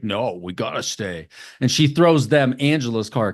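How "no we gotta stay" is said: put-on creepy possessed girl. Possessed Susan insists they stay.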